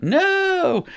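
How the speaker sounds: long, high-pitched drawn-out wail; dismayed cry. Real, not acted. Accidentally saved file, cries out.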